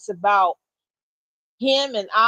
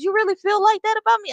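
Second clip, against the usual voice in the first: much higher and fast, caught off guard; wife's high, quick surprised question; startled question; 11 semitones higher, 4 dB louder, much faster than usual. Retold or imitated. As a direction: blurt it out in disbelief.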